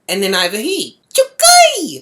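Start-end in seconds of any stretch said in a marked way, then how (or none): 1.1-2.0 high-pitched loud heckle; imitated yell. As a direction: yell it like a taunting boy calling out. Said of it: "three voices yelling out".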